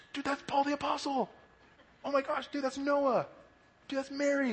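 high, hushed excitement; starstruck awe. Acted. Newcomer spotting Bible figures in heaven, hushed starstruck excitement. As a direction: point out famous people to a friend in an excited undertone. Acted awed sightings in heaven.

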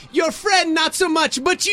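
put-on high Jamaican-accented shout, dismissing the friend. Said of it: "She sounded like Miss Cleo".

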